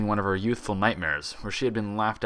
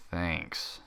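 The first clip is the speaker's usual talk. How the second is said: sarcastic thanks; 3 semitones lower, 7 dB quieter than usual. A narrator's reading.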